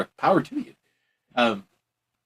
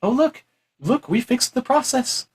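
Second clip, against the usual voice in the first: mock announcing; 10 semitones higher than usual. Officials telling people the process is fixed, mock announcing. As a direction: act out officials showing off their fix.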